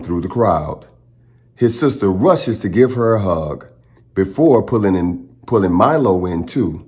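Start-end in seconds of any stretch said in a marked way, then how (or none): none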